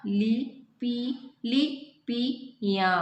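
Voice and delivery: "लिपि, लिपियाँ" drawn out slowly, syllable by syllable, nasal "याँ" at the end; slow correct pronunciation. Shown as a demonstration. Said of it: "तो सही क्या होगा?". ("लिपियाँ" को बहुत धीरे, अनुनासिक "याँ" के साथ)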